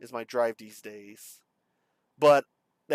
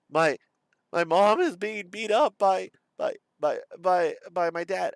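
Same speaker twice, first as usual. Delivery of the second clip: higher, louder, stammering emergency plea; reenacted crying call; 4 semitones higher, 5 dB louder than usual. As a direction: lightly act a distressed child reporting to the operator, tripping over words. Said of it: "I'm crying. I'm like".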